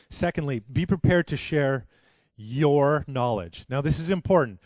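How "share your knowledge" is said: slow, deliberate YOUR, set off by a pause; pause, then stretch out the word your; stress on YOUR. Real, not acted. Preacher stressing whose knowledge to share, emphatic YOUR. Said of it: "I emphasize the word "your".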